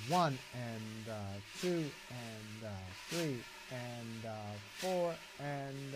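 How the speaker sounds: soft steady count along with the drums; counting aloud. Shown as a demonstration. Teacher counts beats while demonstrating brushes. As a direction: count the beats under the playing.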